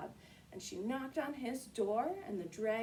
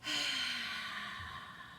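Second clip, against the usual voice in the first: dragon breath; 3 dB quieter than usual. Shown as a demonstration. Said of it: "Can we all do our dragon breath?".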